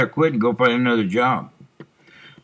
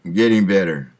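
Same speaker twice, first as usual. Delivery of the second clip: ordinary talk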